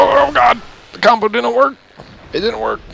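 high, loud panicked wail; high, loud dismayed outburst; freaking out. Real, not acted. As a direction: yelp it in dismay as the combo fails. Combo fails in game.

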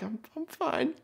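tiny, very high squeak; unconvincing fine. Retold or imitated. Stung man lying to worried friend, small high squeak.

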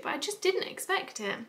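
ordinary talk

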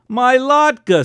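shrill high scream of a frantic mother. A narrator's reading. Mother screaming at her husband in panic. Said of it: "screamed my mother".

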